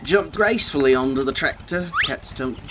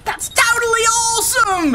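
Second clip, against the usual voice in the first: excited shout. A narrator's reading. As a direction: yell it in delight. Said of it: "Edison shouted".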